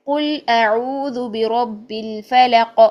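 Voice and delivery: very slow, drawn-out Quran recitation. Shown as a demonstration. Teacher reciting Surah Falaq as an example. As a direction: recite it long, bouncing the final ق. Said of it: "قاف کو ہلا دیا".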